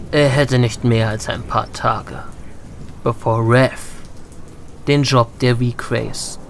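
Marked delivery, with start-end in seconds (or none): none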